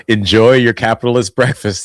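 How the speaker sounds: loud, laughing taunt; Charlie's cheerful jab. Retold or imitated. Host quoting Charlie's line to restaurant protesters, loud laughing taunt. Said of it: "And he was like".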